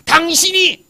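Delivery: loud, high, accusing jab; angry outburst. Acted. Preacher demonstrating a believer furious at God, angry accusation. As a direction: hurl the word out as an angry accusation. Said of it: "너무 화가 나갖고".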